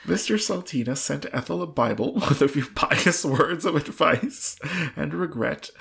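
reading on through loud giggles; laughing. Real, not acted. Reader giggling through the gift list, loud and laughing.

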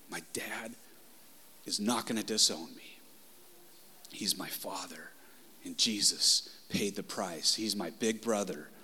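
soft, low, near-whisper, holding it together; choked up, emotional. Real, not acted. Preacher confessing God as his father, hushed and choked up. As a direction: drop almost to a whisper, fighting tears. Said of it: "just kinda wrecks me".

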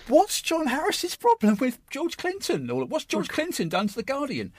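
high-pitched, incredulous mock outrage; mock indignation. Taking a newspaper columnist to task over [name], mock-indignant.

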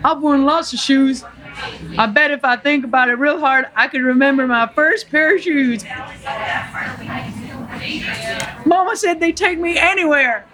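high, earnest Southern simpleton; Forrest Gump. Acted. Forrest remembers his first shoes, high earnest character voice. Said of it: "play the role of Forrest Gump".

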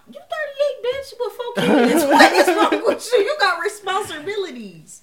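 high, loud laughing outrage; outraged. Real, not acted. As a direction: rant at her in disbelief. Outraged rant at homeless daughter.

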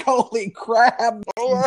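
high, startled holy crap; mock shocked exclamation. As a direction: retell the shock pitched well up, half joking. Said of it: "I was like".

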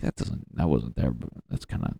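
hushed worried muttering. Retold or imitated. Noticing weight in mirror.

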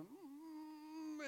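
high, drawn-out closed-mouth whimper; mock trembling-lip whine; imitated pre-cry whimper. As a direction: imitate a small child starting to cry. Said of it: "that lip will get going".

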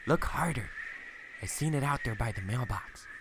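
lightly voiced Barb pressing him to look; wife insisting she saw a goat; insistent wife. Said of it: "She goes".

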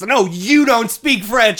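loud, high, drawn-out yell; retold yelling. Arguing back at a French-speaking lady, mock indignant yell. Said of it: "I'm, like, yelling at this".

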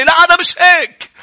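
high, loud put-on voice; high mocking whine; mock excuse. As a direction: play the self-justifying excuse.

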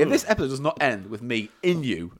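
normal talking voice

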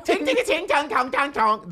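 high, hammered mock-Chinese syllables; caricature Chinese accent. Showing the wrong way to do it, caricature Chinese. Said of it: "We're not talking about Bugs Bunny nineteen forty".

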